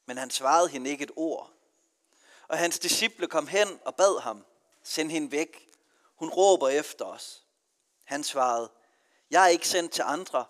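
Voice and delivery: measured, solemn scripture reading; gospel reading. Acted. Priest reading the gospel to the congregation, solemn and measured. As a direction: read the gospel aloud to the church.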